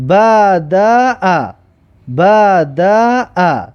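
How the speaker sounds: بدأ drawn out very slowly; slow demo pronunciation. Shown as a demonstration. Teacher sounds out بدأ very slowly for pupils. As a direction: stretch بدأ slowly for the class.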